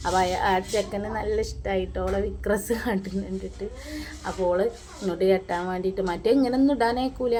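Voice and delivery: normal talking voice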